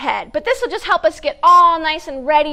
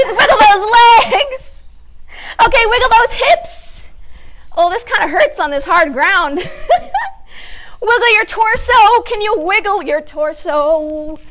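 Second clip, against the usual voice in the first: higher, louder sing-song coaching; upbeat exercise leader; 5 semitones higher, 4 dB louder, slower than usual. Acted. Host to children at storytime, bright sing-song coaching. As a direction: cheer the kids through each wiggle. Leads kids' wiggle warm-up.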